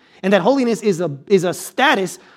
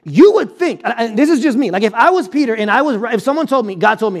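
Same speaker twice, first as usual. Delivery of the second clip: ordinary talk, nothing marked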